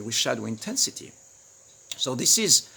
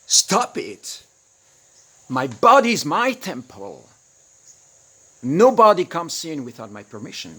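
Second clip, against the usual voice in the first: fierce boundary; 3 semitones higher, 9 dB louder than usual. Pretend. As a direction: draw a hard line with your voice. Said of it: "push that energy and that fire that says".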